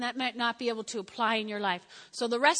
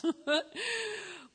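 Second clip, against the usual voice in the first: laugh; 8 semitones higher than usual. Real, not acted. Laughs after own joke.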